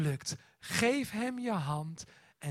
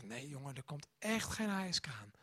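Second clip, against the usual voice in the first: much softer, gentle reassurance; calm the frightened boy quietly; father hushing his son at night, soft and calm; soothing father; 5 semitones lower, 10 dB quieter than usual. Retold or imitated. Father calming scared son.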